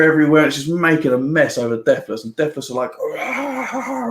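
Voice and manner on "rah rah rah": high, squeaky little rah rah rah; laughing cartoon creature grunts; mock monster roar. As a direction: play the flustered monsters, laughing. Deathless reacting to blaring music.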